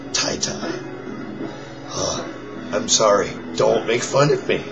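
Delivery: hoarse, raspy near-whisper; forcing air through a worn-out voice; lost voice. Real, not acted. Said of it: "This is me with no voice".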